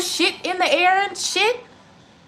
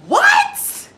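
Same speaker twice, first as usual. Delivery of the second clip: very high, loud squawked what; shocked disbelief; 5 dB louder than usual. Real, not acted. Streamer reacting to calling spirituality demonic, shocked squawk. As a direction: shriek it in disbelief.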